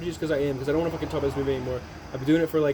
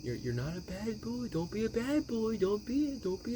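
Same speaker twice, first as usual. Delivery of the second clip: mock pleading mother; 2 semitones higher, 8 dB quieter than usual. Recapping the film, mimicking the daughter acting as his mom, pleading. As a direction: coax like a mother, pitch rising each time. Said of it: "and be like".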